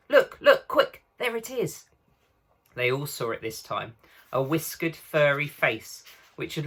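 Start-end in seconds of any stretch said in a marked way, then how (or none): 0.1-1.9 high and louder urgent spotting; urgent excited call. A narrator's reading. Susan spotting the animal, urgent excited call. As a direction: point it out, quick!.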